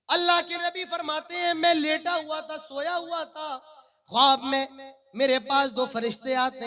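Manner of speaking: loud, declaiming sermon with drawn-out phrase endings; full-force preaching. Acted. Preacher warning his brothers of punishment in hell, preaching at full force. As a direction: thunder out a grave warning to the congregation.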